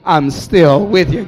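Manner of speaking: booming, strongly stressed promise; loud reassurance. Acted. God answering the last complaint, booming to the crowd. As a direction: throw it out at full volume.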